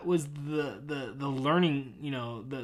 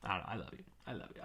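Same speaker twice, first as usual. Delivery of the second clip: soft apology; 7 semitones lower, 15 dB quieter, much faster than usual. Real, not acted. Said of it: "I'm not trying to be mean".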